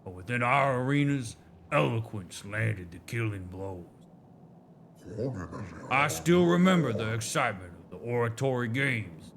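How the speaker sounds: light Southern drawl for a storytelling elder; a hint of a Southern drawl, proud and nostalgic. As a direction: read it like a Southern elder recalling old glories. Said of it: "the southern molasses coming out of their mouths".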